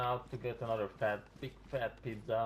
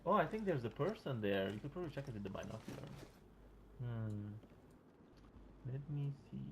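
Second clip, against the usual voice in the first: usual speaking voice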